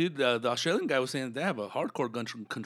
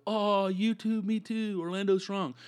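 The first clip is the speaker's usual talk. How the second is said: higher, sing-song fake sympathy; mocking pandering comics; 9 semitones higher, 3 dB louder, slower than usual. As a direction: parody a comic cashing in on a tragedy. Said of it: "one of those comics that ... go".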